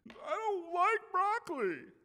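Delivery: put-on high, slow whining child voice; whiny kid. Parent voicing a child who refuses broccoli, high whiny. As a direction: pout it out like a picky kid.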